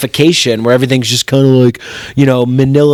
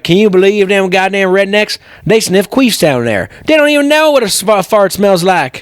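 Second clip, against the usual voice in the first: put-on North Carolina accent; 5 semitones higher than usual. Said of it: "You're doing the wrong accent".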